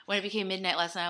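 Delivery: ordinary delivery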